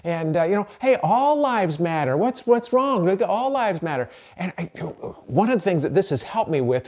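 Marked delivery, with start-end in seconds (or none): 0.8-3.1 higher, slow, mock-innocent protest; defensive slogan. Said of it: "all the rhetoric". 3.1-4.1 "lives" leaned on, higher and insistent; mock defensive. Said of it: "all the rhetoric".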